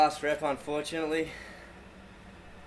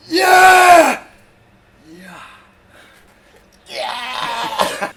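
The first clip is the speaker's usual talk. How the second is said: high, loud triple yell; gym shout; 12 semitones higher, 20 dB louder, much slower than usual. Acted. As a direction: pump yourself up, yelling. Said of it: "you've gotta be like".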